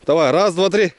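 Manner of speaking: loud heave count; effort count. Real, not acted. Heaving log together.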